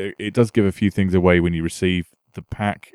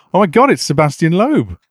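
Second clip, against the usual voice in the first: mock astonishment; 7 semitones higher, 6 dB louder than usual. Pretends the star is at door.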